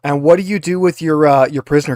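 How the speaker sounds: normal talking voice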